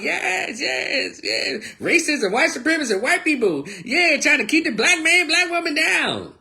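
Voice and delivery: very high, squealing mock cheer; hammed-up high-pitched rally cries; mock cheering crowd. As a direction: imitate gullible supporters cheering along. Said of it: "y'all so busy cheering them out".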